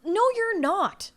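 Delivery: high, slow, drawn-out no; emphatic retort. Acted. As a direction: stretch the no out high. Retorting to imagined pop star.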